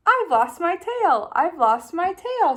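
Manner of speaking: high, loud singsong cheer; happy singsong. Acted. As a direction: sing it out with joy. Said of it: "she sang".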